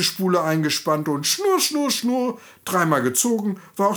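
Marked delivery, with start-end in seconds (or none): 1.6-2.4 high, playful whirring sound; spinning wheel whirr. Acted. The narrator imitates the spinning wheel for the children, high and playful. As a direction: hum it like a spinning wheel turning.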